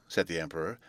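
usual speaking voice

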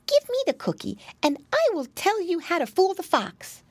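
high-pitched storybook mouse; small, high creature voice; little mouse. A narrator's reading. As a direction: advise as a clever tiny mouse. Mouse bargains with piglet.

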